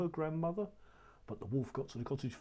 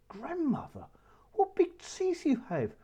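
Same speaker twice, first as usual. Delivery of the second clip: put-on very high, slow and louder childlike wonder; little girl voice; 13 semitones higher, 5 dB louder than usual. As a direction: draw it out like a frightened little girl. Child questions the disguised wolf.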